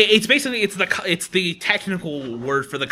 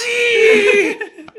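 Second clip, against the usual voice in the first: much higher, loud crying shout; anguished anime scream; 10 dB louder than usual. Retold or imitated. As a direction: scream it like a dramatic anime hero. Fighting game quotes.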